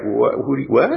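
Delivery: mock-baffled, rising on the last what; playfully stunned questioning; taken aback. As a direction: act out being caught off guard, half-joking. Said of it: "You might be taken aback".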